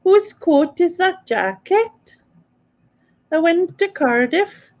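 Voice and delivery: high, slow attempt at Welsh; mock Welsh accent. Repeating the housemate's phrase, in a high mock-Welsh accent. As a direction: copy a Welsh accent, pitched high and drawn out. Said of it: "What part of Wales is that then?".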